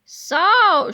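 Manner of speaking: loud drawn-out gotcha so; shouted so. Acted. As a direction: seize on the discovery. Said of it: "shouted Sir Stinks-a-Lot".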